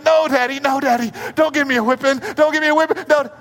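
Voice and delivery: put-on high, loud childish begging; whiny pleading child. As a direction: beg like a little girl about to be spanked. Daughters pleading, voice raised high.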